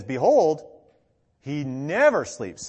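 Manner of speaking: BEHOLD loud, then NEVER stretched and punched; loud, slow stress on NEVER; emphatic declaration. Retold or imitated. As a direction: proclaim it, hitting BEHOLD and NEVER. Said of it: "it's a more extensive prohibition".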